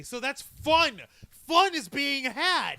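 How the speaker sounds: hammed-up fun; mock enthusiasm. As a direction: stretch it out in put-on cheer. Mock celebration after a flat yay.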